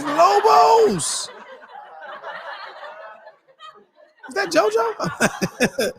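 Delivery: high, laughing disbelief, slowed. Retold or imitated. Caught off guard by the episode, high laughing disbelief. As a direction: laugh out the question in shock. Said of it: "I was like".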